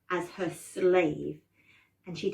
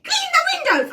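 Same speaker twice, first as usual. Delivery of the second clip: very high, loud witch command; bossy witch; 7 dB louder than usual. Shown as a demonstration. Showing the game's orders, shrieking bossy witch. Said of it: "I'm the witch".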